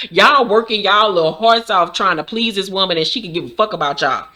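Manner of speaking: usual speaking voice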